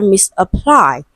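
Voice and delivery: "misapply" with the weight on -PLY; stress on last syllable. Shown as a demonstration. Teacher reads the option to students, "misapply" stressed on -PLY. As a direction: land firmly on the third syllable of "misapply". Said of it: "stressed on the third syllable".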